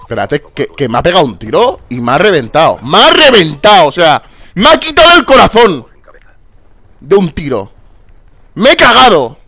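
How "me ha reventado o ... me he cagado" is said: high, loud, indignant shouting; furious gamer outburst; outraged yelling. Real, not acted. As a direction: yell it like you can't believe it. Just got killed by one shot.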